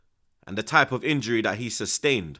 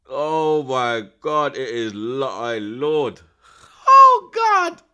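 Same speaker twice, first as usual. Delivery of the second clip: much louder and higher, drawn out slowly; stunned exclaiming; 6 semitones higher, 14 dB louder, much slower than usual. Real, not acted. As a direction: cry out in disbelief at the miss. Reacting to a big chance.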